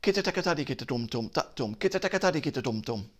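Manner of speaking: rapid konnakol syllables, quick and light; fast syllables. Shown as a demonstration. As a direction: rattle them off fast. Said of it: "so the syllables would change".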